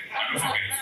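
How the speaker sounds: breathy mock-menacing whisper; eerie stage whisper to rattle rivals; spooky whisper. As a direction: whisper it to psych out the poets. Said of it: "trying to psych you out".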